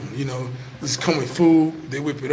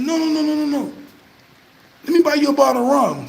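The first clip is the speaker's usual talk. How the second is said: insistent voice; 11 semitones higher than usual. Retold or imitated.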